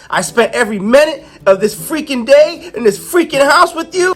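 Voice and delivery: high, ranting acted exasperation; pent-up outburst. Ro unleashing on the ghost after being cooped up, exasperated. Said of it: "bottle up energy is just unleashing in her".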